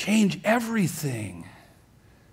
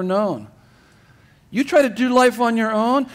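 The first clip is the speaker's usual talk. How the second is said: raised, loud building sermon voice, higher; fervent preaching; 5 semitones higher, 3 dB louder than usual. Acted. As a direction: preach it with rising fire to the congregation. Preaching at full force.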